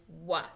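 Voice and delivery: WH said "wa"; digraph sound. Shown as a demonstration. Teacher to young readers, isolated "wa". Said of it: "it says".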